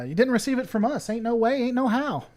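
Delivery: alien voice. Acted. Alien denies sending signal, lightly voiced defiance. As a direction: brush off the captain a touch defiantly. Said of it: "He said, uh".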